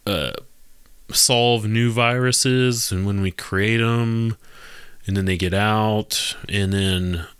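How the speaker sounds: slow, deliberate lecture to listeners; slow moralizing. Real, not acted. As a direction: spell out the lesson slowly, like a warning. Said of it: "slow preachy takeaway".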